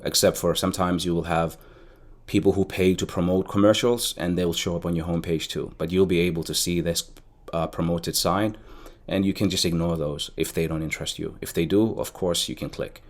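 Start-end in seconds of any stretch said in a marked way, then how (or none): none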